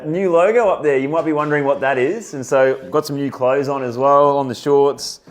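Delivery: high, loud, eager showing off; excited. Real, not acted. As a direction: present the new logo with excitement. Excited showing new logo.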